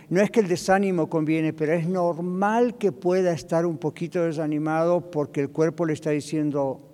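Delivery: normal talking voice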